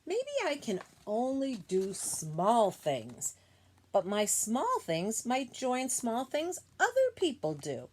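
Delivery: gentle, measured read-aloud voice; storybook reading voice; reading to children. Acted. Reads picture book to class.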